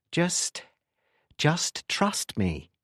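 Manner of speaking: mildly irritated, halting and slow; irritable. A narrator's reading. Tim brushing off Anderson on the phone, mildly irritable. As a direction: spell it out slowly, a touch irritably.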